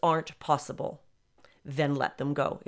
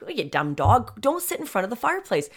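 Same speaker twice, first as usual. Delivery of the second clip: frustrated husband; 5 semitones higher, 3 dB louder than usual. Retold or imitated. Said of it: "Keith sometimes gets frustrated with her".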